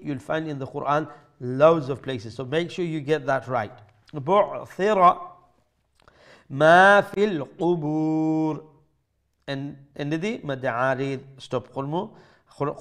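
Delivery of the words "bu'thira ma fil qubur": slow, drawn-out chant; recitation. Shown as a demonstration. Teacher reciting the verse slowly for students. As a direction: stretch each vowel long. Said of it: "teacher recites verse".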